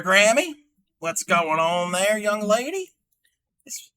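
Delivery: ordinary talk, nothing marked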